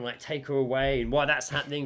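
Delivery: usual speaking voice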